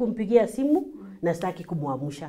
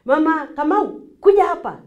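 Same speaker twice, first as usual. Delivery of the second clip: summoning; 7 semitones higher, 5 dB louder than usual. Acted. Parent summoning, as unsuitable example.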